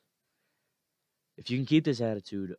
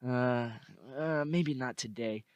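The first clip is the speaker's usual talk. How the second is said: hesitant voice of doubt; 3 semitones higher, slower than usual. Retold or imitated. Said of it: "that little voice of doubt".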